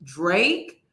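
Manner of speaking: low questioning start, rising on DRAKE; puzzled disbelief. Real, not acted. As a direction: ask DRAKE as if you misheard. Incredulous repeating of the name.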